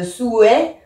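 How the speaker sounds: "souhait" slowly in two syllables, sou-hait; two vowel sounds. Shown as a demonstration. Teacher showing the expected poetic form, "souhait" split sou-è. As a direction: separate ou from è in "souhait". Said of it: "on devrait dire souhait".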